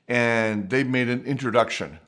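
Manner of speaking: normal talking voice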